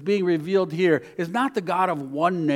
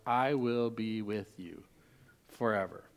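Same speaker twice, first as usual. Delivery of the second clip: tender promise; 7 semitones lower, 11 dB quieter than usual. Acted. Jesus's promise, softly voiced.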